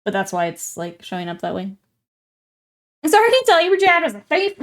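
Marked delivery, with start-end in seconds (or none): 3.0-4.5 high, loud, amused mock verdict; mock appraiser. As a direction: deliver hammed-up bad news, on the edge of laughing. Jokingly acts appraiser breaking bad news.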